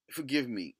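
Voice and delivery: ordinary talk, nothing marked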